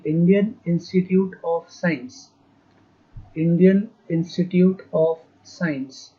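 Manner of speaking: word by word, unhurried; slow dictation. Real, not acted. Teacher dictating an institute's name, slow dictation. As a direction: stretch out each word for note-taking. Dictating full form for writing down.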